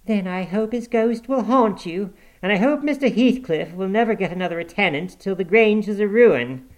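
high-pitched, cutting woman's retort; sharp, spiteful comeback; sharp answer. A narrator's reading. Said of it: "she answered sharply".

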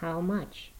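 very soft, high, dry-lipped question; hushed, horrified asking; barely voiced dread. A narrator's reading. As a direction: shape the words with almost no voice. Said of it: "His dry lips shaped the words".

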